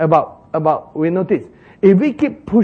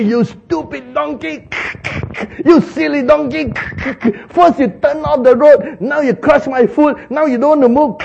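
higher, louder mock scolding; 10 semitones higher, 4 dB louder than usual. Balaam cursing his donkey on the road, raised mock scolding. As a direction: tell off the stupid donkey in a raised voice. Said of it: "He must be scolding and cursing the donkey".

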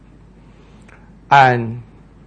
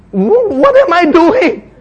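higher, louder, slow alarmed question; panicked Peter; 12 semitones higher, 5 dB louder, slower than usual. Acted. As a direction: realize you're on the water. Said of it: "and said".

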